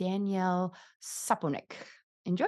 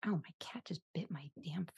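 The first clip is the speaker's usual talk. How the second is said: hushed whispered aside; startled whisper about the cat; real whisper; 3 semitones lower, 14 dB quieter than usual. Real, not acted. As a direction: whisper it so the prayer isn't broken. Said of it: "You're ruining the prayer".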